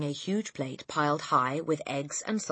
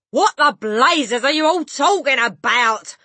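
loud, slow, high-pitched bellow; angry yell; 8 semitones higher, 11 dB louder, slower than usual. A narrator's reading. Father to family, bewildered angry yell. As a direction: yell it in baffled outrage. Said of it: "the father yelled".

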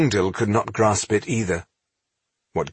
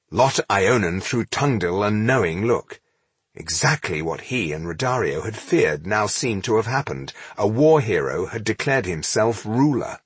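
usual speaking voice

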